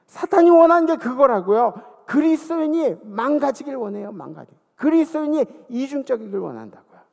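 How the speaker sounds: loud, pressing preaching voice. Real, not acted. Pastor warning the congregation, loud and pressing. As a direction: hammer the warning.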